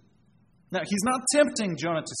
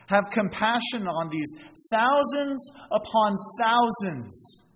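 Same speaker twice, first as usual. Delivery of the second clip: God pleading; 6 semitones higher, 3 dB louder than usual. Retold or imitated. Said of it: "God is asking Jonah".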